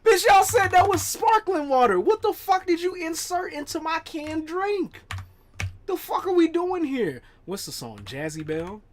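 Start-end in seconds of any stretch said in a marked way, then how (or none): 0.0-7.2 mock outrage. Real, not acted. To friends about the spiked drink, high mock outrage. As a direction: yelp it like the drink was spiked.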